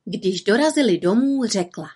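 ordinary delivery, nothing marked

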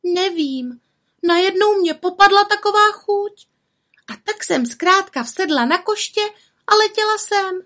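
frightened little witch. A narrator's reading. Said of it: "se třásla strachy".

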